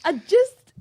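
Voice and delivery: building from firm to a high, loud mock shriek; mock outburst. Friend voicing the film heroine, rising to a mock disgusted shriek. Mocking the heroine's resigned thoughts.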